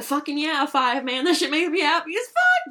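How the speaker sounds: loud, high burst of enthusiasm; hyped-up and shouting; excited. Real, not acted. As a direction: gush at full volume, pitch climbing. Gushing over favourite thing, eating out.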